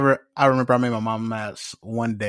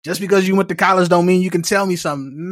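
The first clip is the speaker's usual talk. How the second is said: raised, higher know-it-all relative; mock scolding relative; 7 semitones higher, 4 dB louder than usual. Relative shutting down the college grad, mock scolding. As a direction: lecture the college kid, higher and louder. Family member scolding the speaker.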